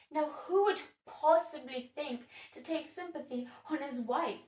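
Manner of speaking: lightly dramatic pity, broken by pauses; Sylvia, moved by the wife. Acted. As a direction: describe the despondent wife with stagey concern. Sylvia pities the crying wife.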